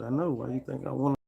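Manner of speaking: high, loud playful sass; voicing his sassy friend. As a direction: toss it back as a cheeky comeback. Voicing friend's flirty comeback.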